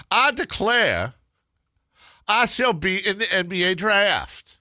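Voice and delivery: high, slow, loud Southern declaration; Southern accent. Acted. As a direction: proclaim it in a put-on Southern drawl. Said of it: "do it in a Southern accent".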